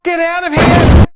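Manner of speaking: high, loud furious yell; furious shout. Acted. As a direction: scream it in outrage. Furious reaction to bad news.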